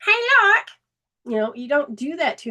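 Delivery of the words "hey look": high, loud teasing call to the whole room; mock calling out. A mocker loudly calling attention to a friend's toilet paper, high and loud. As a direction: announce someone's embarrassment to everybody.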